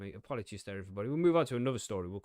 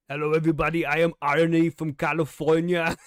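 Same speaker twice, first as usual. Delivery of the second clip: Arnie impression; 4 semitones higher, 13 dB louder than usual. Streamer impersonating chat member Arnie, hammed-up loud. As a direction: ham up a fake guest introducing himself. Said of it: "and I would have gone".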